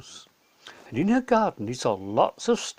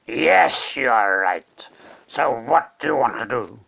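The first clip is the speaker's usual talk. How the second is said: slow regal lion voice, lower; calm lion king; 3 semitones lower, 6 dB louder than usual. Acted. Aslan answers the witch, calm and regal. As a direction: answer with steady authority.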